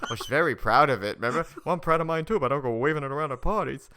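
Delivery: loud, hammed-up movie reply; acted comeback. Retelling Rodney's classic lines, loud mock retort. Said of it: "He goes".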